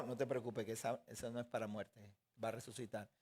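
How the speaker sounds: low, soft, soothing reply; calm reassurance. Acted. Yeshua answers news of a death, calm reassurance. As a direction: reassure gently, dropping pitch and volume.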